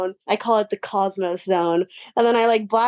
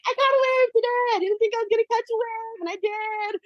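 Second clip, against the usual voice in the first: much higher, rushing and thrilled; retold giddy joy; 11 semitones higher than usual. Surfer replaying the moment a second time, now high and thrilled. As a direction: squeal it out like a kid. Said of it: "I was like".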